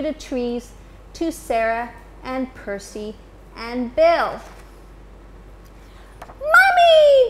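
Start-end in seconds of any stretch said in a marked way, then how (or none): 6.4-7.3 very high, loud cry of joy; baby owls crying out for mommy; joyful cry. Acted. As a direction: shout it as the babies see her return. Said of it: "they cried".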